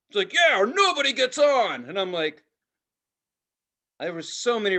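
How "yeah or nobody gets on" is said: put-on loud, high kid giving orders; bossy little kid. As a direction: imitate a little conductor barking instructions. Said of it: "it's this little kid dressed like a conductor".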